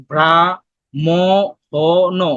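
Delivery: ব্রা, ম, হ, ন spoken as separate pieces with pauses between; syllables split apart. Shown as a demonstration. Teacher splits ব্রাহ্মণ into sounds for students. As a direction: break ব্রাহ্মণ into ব্রা, ম, হ, ন one by one. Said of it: "ভাঙলে তুমি কি কি উচ্চারণ করতেছো?".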